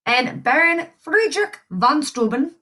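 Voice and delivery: put-on name said with a light German accent; playful touch of German accent. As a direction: give the name a hint of German. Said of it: "so hard to not say that in that accent".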